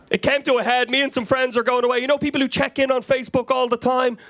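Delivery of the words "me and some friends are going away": normal talking voice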